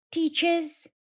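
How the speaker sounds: usual speaking voice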